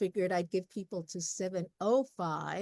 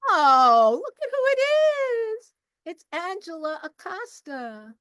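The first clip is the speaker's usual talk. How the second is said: big, high singsong squeal of recognition; delighted greeting; 10 semitones higher, 11 dB louder than usual. Real, not acted. Spots an early arriver joining.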